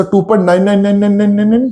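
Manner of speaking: student chanting on without a break; endless run-on nines. Acted. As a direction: reel the nines off as if they never end. Acts student reeling off endless nines.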